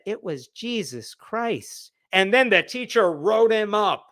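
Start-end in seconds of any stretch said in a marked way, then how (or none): 0.0-1.9 soft, slow imitation of an earnest kid; hushed, sweetly sincere mock answer; innocent boy answering. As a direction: answer like a devout little boy, hushed and drawn out. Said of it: "And the boy said".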